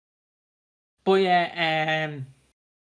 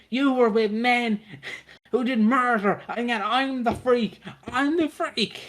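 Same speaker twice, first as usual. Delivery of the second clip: high, slow, wounded whine; whiny Theo; 7 semitones higher than usual. Retold or imitated. Theo accusing Stacey, high slow whine. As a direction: whine it like a self-pitying stalker. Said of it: "he's like".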